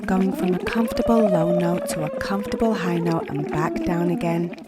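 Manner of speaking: soft, relaxed coaching voice; calm guided exercise. Acted. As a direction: guide them gently through warm-ups. Coach guiding a vocal exercise.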